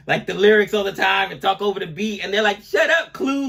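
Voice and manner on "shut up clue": higher-pitched mock heckle; mock exasperation. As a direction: imitate fed-up listeners telling off a DJ. Voicing annoyed listeners at talkative DJ.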